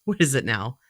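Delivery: normal talking voice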